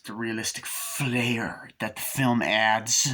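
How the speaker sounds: slow, hammed-up rock-star drawl. Shown as a demonstration.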